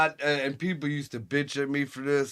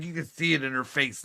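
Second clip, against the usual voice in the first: normal talking voice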